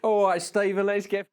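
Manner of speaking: put-on high, loud comic British accent. Friend agreeing to get drunk, high comic British accent. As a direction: burst into the silly British bit voice. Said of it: "There it is".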